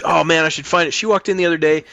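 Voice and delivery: ordinary talk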